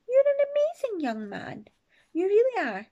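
a hint of a high fond granny; lightly voiced granny. A narrator's reading. Granny hugging her grandson, lightly voiced fond reassurance.

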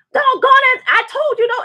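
high, loud hammed-up yell; mock yell. Neighbor yelling at the game, loud high mock shout. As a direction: shriek it like a sports fan losing it. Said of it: "I heard him over there screaming".

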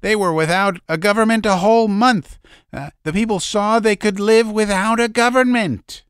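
put-on high, loud, fast old man's outburst; a hint of a fiery old man; heated outburst. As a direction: keep shouting him down. Old man still crying out.

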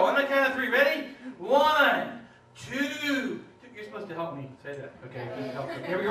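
normal talking voice

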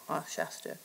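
ordinary talk

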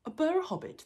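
high, loud startled trolls. A narrator's reading. Trolls repeating the hobbit's odd name, startled. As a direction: echo it in loud disbelief. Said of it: "they said, startled".

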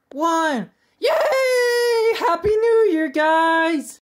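high, loud excited shouting at midnight; countdown cheer. Acted. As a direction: cheer the New Year in at the top of your voice. New Year countdown reaching zero.

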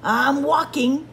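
snapped. A narrator's reading. Grumpy Bird answers Raccoon, snapping. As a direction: snap back, fed up. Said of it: "snapped Bird".

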